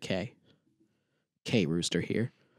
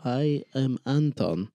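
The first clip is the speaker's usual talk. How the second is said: mock Russian accent; slower than usual. Mock Russian self-introduction.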